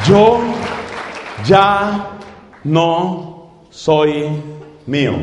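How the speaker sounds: each word set apart by long pauses; slow spaced phrase. Shown as a demonstration. Preacher to congregation, slow word-by-word emphasis. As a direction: drop it word by word, letting each land. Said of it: "Esas palabras resumen el capítulo".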